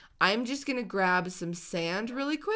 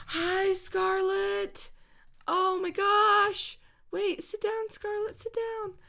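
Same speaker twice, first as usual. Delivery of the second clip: higher, slow cooing; 11 semitones higher, slower than usual. Real, not acted. Gamer coaxes puppy Scarlet, gentle coo.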